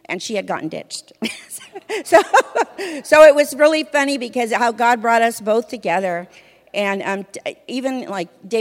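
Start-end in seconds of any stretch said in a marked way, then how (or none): 1.2-2.0 high laugh. Real, not acted. Laughing at ironic ditching story. 2.0-6.3 louder, talking through real laughter; laughing about God bringing them together. Real, not acted. Laughing at ironic ditching story.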